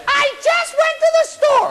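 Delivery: high, drawn-out yell from another room; shrieking mock-mother holler. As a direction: screech it as an exasperated mom. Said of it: "your mother yell that stuff from the other room".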